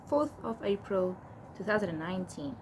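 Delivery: ordinary talk, nothing marked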